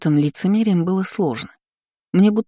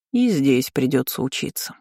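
lower, sighing and resigned; sad sigh; 4 semitones lower than usual. A narrator's reading. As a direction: sigh it out sadly. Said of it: "печально вздохнула я".

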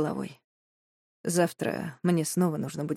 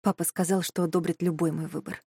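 plain reading voice

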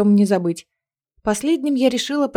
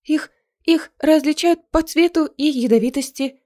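nervous stammer; 6 semitones higher than usual. Acted. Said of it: "продолжила Мисси".

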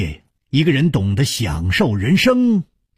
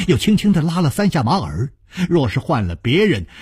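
plain reading voice

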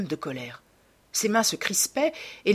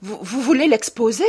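stunned question rising louder and higher; alarmed exclamation; 5 semitones higher, 6 dB louder than usual. A narrator's reading. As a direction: exclaim in dawning dread. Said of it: "s'exclama Dorian Gray".

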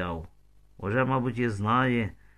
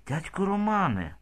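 tearful; 8 semitones higher than usual. A narrator's reading. Girl greeting Roman at the window, understated tears. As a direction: cry out the name, pitched up. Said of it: "І заплакала".

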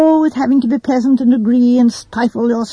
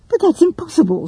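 higher-pitched, quick objection; quick interjection; 7 semitones higher than usual. A narrator's reading. Joyce objecting to Miss Marple's solution, quick interjection. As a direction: jump in with a mild protest. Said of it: "said Joyce quickly".

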